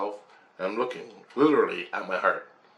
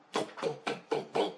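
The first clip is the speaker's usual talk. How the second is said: breathy whap sounds ending in a boom; voiceless whap whap whap, then boom; imitated impacts; 8 dB quieter than usual. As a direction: mouth the hits like a sound effect. Imitating pounding energy.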